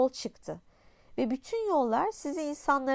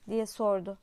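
usual speaking voice

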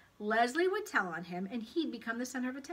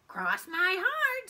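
high, loud, drawn-out oath; stretched, squeaky promise; tempting pigtail; 11 semitones higher, 8 dB louder, much slower than usual. A narrator's reading. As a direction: swear it slowly like a tiny tempter. Pigtail swears to Paul.

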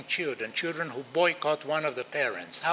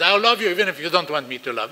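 put-on louder and fast, yelling it at the child; shouted declaration; 8 dB louder, faster than usual. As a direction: blurt out your love as a shout. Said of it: "you can scream at the child".

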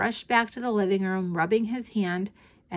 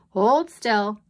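usual speaking voice

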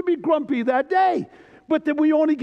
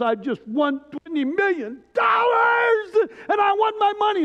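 put-on grumpy old man shouting; 9 semitones higher than usual. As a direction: bellow it like a stubborn old crank. Grumpy lottery winner demands money.

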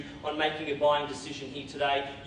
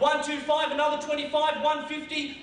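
auctioneer calling; 11 semitones higher, 3 dB louder than usual. Acted.

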